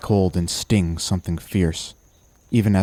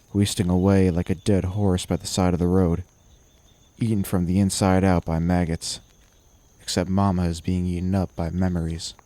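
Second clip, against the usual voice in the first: ordinary delivery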